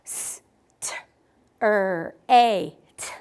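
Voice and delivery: sounds said one at a time; sounds segmented. Shown as a demonstration. Teacher models segmenting "Straight" for the children. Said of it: "Watch me do it first".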